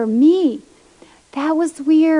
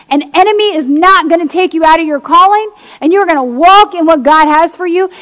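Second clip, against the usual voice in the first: louder, bold and unwavering; firm declaration; 3 semitones higher, 6 dB louder than usual. Pretend. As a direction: declare it over him with conviction. Speaking faith over discouraged husband.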